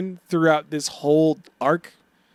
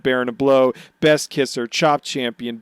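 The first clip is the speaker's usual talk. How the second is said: normal talking voice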